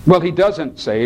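normal talking voice

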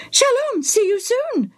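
very high parrot squawk. A narrator's reading. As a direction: mimic like an eager bird. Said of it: "the bird repeated".

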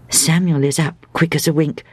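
ordinary delivery, nothing marked

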